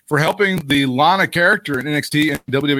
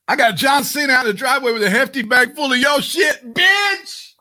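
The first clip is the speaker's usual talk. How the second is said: mock yelling boss; 11 semitones higher than usual. Boss calling up a fired wrestler, mock yelling at her. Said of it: "Is that what Mark Carrano was doing?".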